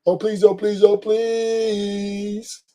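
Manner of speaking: mocking a man pleading to be taken back; mock begging. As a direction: beg softly and pathetically. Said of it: "[name]'s begging her back".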